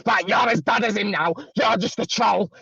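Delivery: imitating a petty admin's huffy accusations; mock accusing rant. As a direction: ham up his petty outrage. Mocking his accusations.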